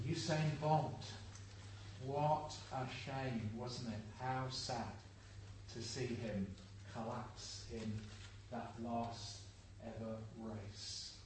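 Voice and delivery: low, soft and regretful; hushed lament. Real, not acted. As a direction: sink into a quiet, sorrowful hush. Laments runner's collapse in final race.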